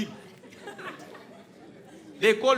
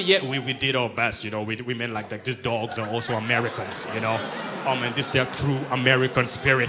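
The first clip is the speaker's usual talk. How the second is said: lower, proud mock fireman; mock American firefighter; 10 semitones lower than usual. Fireman telling the news about the dog, in a lower proud mock voice. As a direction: boast like a humble hero on TV.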